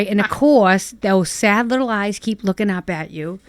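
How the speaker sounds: normal talking voice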